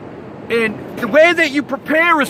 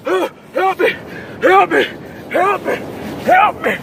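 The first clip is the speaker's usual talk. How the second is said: high, hammed-up cries for help; mock panic; 5 semitones higher than usual. Surfer miming being tossed by a wave, mock cries for help. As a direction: plead for help like you're drowning. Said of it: "And then you're like this".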